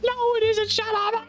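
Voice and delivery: very high, mock whiny kid voice; whiny protest. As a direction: squeak back like a sulky kid. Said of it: "I was like".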